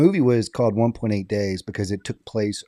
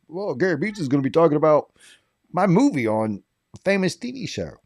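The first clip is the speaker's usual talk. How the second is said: fast, higher, boastful brag; bragging; 6 semitones higher, faster than usual. Retold or imitated. Bragging to everybody about his movie on TV, fast and proud. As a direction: show off to everybody. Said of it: "'cause I told everybody".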